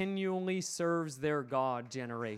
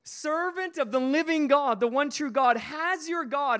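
high, strained cry of a distressed king; anguished call; 6 semitones higher, 5 dB louder than usual. Acted. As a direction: call out in anguish toward the den. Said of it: "In an anguished voice".

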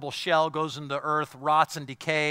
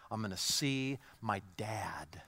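whisper; 6 semitones lower, 9 dB quieter than usual. Real, not acted. Tender hope about father.

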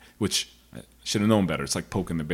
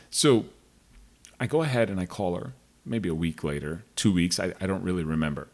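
normal talking voice

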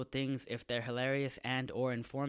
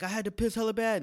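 put-on whiny drunk excuse; 9 semitones higher, 7 dB louder, faster than usual. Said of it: "You were like".

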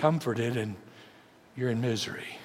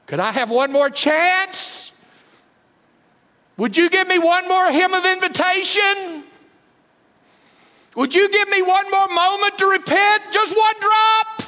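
anguished plea; 10 dB louder than usual. Acted. Preacher pleads at full force.

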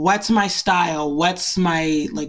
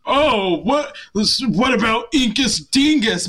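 sister's sarcastic suggestion, slow and raised; really sarcastic; 4 semitones higher, slower than usual. Retold or imitated. Sister suggesting a joke handle in the group chat, really sarcastic. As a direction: imitate a sister offering a silly idea with heavy sarcasm. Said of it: "being, like, really sarcastic about it".

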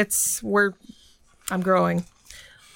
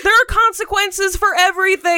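loud; 12 semitones higher, 4 dB louder than usual. Acted. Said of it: "You have hit your loud".

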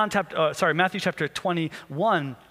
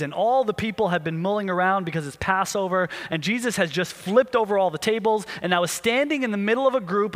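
usual speaking voice

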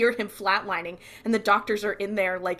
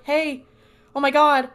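higher, louder retold alarm; alarmed shout; 6 semitones higher, 8 dB louder than usual. Dean runs up to a dying woman, alarmed call.

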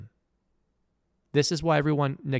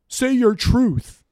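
high, loud, slow mocking sing-song; loud pitched-up parody of "say your truth"; 8 semitones higher, 9 dB louder, much slower than usual. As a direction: belt out the slogan high to ridicule it. Mocking the slogan again.

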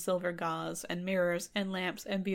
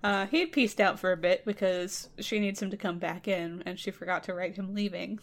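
ordinary talk, nothing marked

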